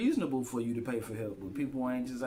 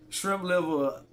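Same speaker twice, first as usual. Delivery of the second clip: loud, higher shrimp liver left to trail; higher and louder, not run on; drawn out, left hanging; 6 semitones higher, 6 dB louder, much slower than usual. Shown as a demonstration. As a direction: say it loud, then let liver hang. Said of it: "then just let it dry out".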